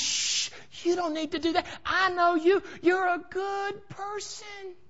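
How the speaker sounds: high, coaxing acted voice; hushing reassurance. Somebody in the crowd talks a seeker out of it, high hushed coaxing. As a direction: shush sweetly in a high voice, slowing at the end.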